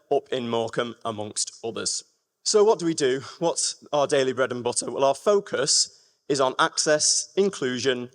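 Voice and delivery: usual speaking voice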